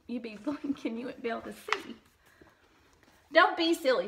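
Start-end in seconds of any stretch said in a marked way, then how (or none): 3.3-4.1 cheerful Frog. Acted. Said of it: "said Frog".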